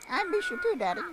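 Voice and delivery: put-on high, sweet small kid; a tiny child missing her dad; little child voice. Youngest kid telling dad on the radio she misses him, high and sweet.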